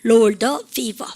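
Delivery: slow, loud model sentence; teacher drilling slowly; slow model phrase. Shown as a demonstration. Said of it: "로월 시작".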